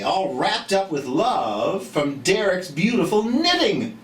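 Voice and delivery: lively, sing-song picture-book reading; reading to children. A narrator's reading. Teacher reading a storybook aloud to children, lively and sing-song. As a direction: read it brightly to a group of little kids.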